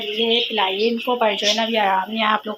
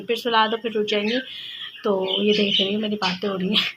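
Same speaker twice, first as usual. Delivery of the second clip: usual speaking voice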